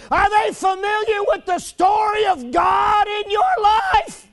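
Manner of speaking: high, loud shouted challenge; hollering who is your God at the crowd. Retold or imitated. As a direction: shout the questions out like a street preacher. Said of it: "You just holler out like that".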